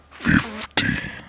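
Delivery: drawn-out, growling horror announcer; spooky countdown voice. Acted. Narrator announces the next creepy story, spooky countdown voice. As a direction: boom the number out long and eerie.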